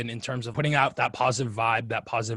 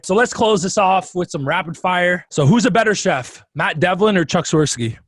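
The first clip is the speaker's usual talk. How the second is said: excited host; 5 semitones higher, 6 dB louder than usual. Real, not acted. Host launches rapid fire round.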